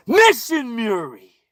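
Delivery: high, drawn-out holler; shouted catchphrase. Pretend. Ranting about where he comes from, sudden high holler. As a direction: belt it high, stretching the syllables.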